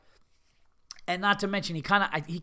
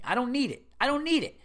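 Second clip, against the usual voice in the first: high-pitched, escalating refusal; indignant customer refusing the hassle; exasperated protest; 7 semitones higher than usual. Acted. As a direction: repeat it higher, utterly fed up. Escalating protest in imagined complaint.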